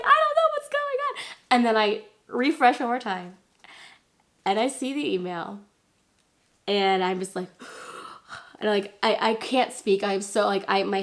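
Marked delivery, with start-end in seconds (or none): 0.0-1.2 very high mock wail; squealed retold distress; panicked confusion. Panicked reply while waiting. 7.5-8.5 breathy, acted gasp of shock; soft voiceless intake of breath; stunned gasp. Acting stunned at seeing email.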